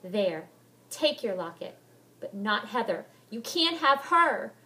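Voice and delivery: loud defiant cry, rising at the end; cried out. Acted. Molly crying out to Helen as the locket flies. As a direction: hurl it at the ghost. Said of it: "I cried".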